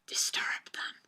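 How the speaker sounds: unvoiced hushed echo, all breath; whisper. Player by a sleeping medium, whispering. Echoes own line in hushed aside.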